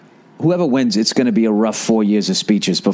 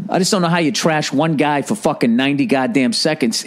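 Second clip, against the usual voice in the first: ordinary delivery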